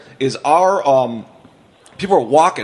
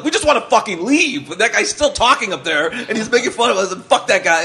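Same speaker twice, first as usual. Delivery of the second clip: higher, mock-annoyed crowd complaint; 7 semitones higher than usual. Stranded audience griping about the comic, mock annoyed and higher. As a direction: whine it like stuck audience members wanting out.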